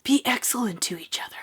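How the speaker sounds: hushed, husky Californian bro; cheesy fake Californian bro accent, softly delivered; bad Californian bro accent. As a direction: put on a bad Californian bro voice, half whispered. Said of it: "my bad Californian bro accent".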